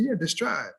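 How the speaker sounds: "describe" confidently said high as "distribe"; mispronounced describe. Retold or imitated. Student confirming to his professor, mispronounced describe. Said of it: "Did you say describe or describe".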